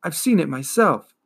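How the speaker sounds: put-on boy warning the turtle, urgent. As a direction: insist it is spreading. Boy continues crying out.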